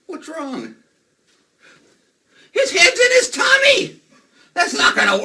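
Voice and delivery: high, loud cry of alarm; mock shock. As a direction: cry out in fake dismay. Magician fakes shock at the trick.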